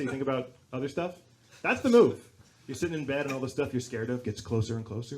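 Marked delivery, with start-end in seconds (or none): none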